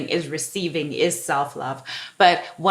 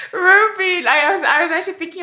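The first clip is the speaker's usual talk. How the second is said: excited burst; 11 semitones higher, 5 dB louder, faster than usual. Real, not acted. Bursting in after laughter.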